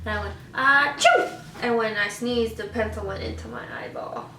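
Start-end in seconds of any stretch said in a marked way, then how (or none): 0.5-1.3 mock sneeze. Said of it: "I had to sneeze".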